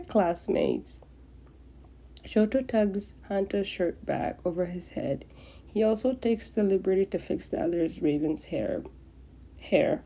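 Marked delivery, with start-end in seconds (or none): none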